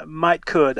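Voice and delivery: "might could" said as one run, stress on might; dialect demo. Shown as a demonstration. Professor demonstrating a Texas double modal. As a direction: give "might could" as a Southern example. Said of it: "as in".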